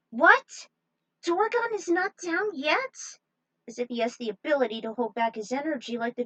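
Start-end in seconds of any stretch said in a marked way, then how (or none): 0.1-3.0 higher, startled disbelief; mock shock at an enemy still standing. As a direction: react like the boss just got back up. Reacting as character to boss surviving.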